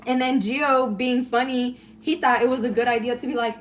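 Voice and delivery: usual speaking voice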